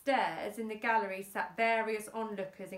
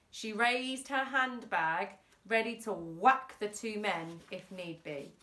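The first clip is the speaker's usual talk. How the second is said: ordinary delivery, nothing marked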